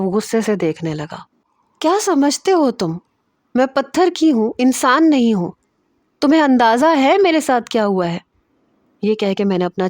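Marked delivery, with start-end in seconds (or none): 1.8-8.2 high, loud, hurt and furious; angry outburst. Acted. Natasha venting her pain at Zain before crying, high angry shout. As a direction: let it burst out of her, pitch climbing.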